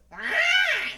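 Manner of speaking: high, loud mock shriek; mimicked scream. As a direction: mimic a startled yell back. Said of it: "And he goes".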